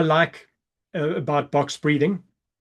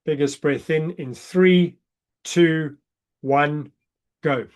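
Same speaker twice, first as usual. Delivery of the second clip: breath countdown; slower than usual. Shown as a demonstration. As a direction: count down and let them breathe out. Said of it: "I'll count you down".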